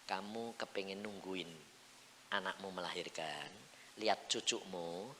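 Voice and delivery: low, soft voice of God; suara Tuhan. Acted. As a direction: ask gently and quietly. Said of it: "Tuhan bilang". (suara Tuhan yang lembut)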